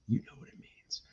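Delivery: breathy knowing whisper. Real, not acted. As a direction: whisper it like a shared secret. Knowing aside to viewers.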